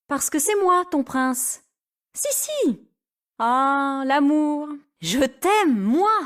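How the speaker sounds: mock romantic prince. Rémi teasing, playing prince.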